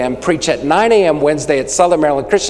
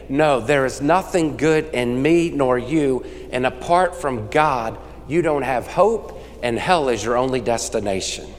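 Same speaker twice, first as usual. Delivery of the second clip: solemn preaching; 3 semitones lower, 6 dB quieter than usual. Acted. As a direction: lower it and let the words land gravely.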